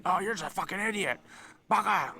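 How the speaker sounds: high-pitched mock comic insult; loud, rude insult. Acting out a Japanese comedian insulting someone, loud and rude. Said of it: "really, really loud or really rude".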